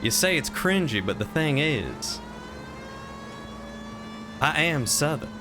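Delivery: lightly put-on Southern US accent. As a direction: insist on it with a Southern twang. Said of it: "to do a Southern accent".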